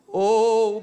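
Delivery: loud, high, half-sung preaching; preaching climax. Acted. Pastor chanting loud over music to the church.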